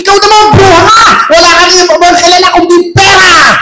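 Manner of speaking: high angry mock yell; husband yelling back. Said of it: "gumanti yung lalaki".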